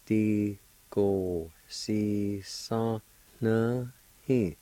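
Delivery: "Ti ko si sa na hi" very slow, syllables drawn out; slow sounded-out pronunciation. Shown as a demonstration. Teacher repeating the word for explained, slowly sounded out. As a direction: stretch each syllable of "Ti ko si sa na hi". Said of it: "It's pronounced like this".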